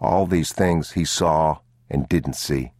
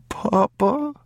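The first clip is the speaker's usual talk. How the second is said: put-on high, small child's voice; a scared young boy tugging at his father; boy's voice. A narrator's reading. Boy anxious question.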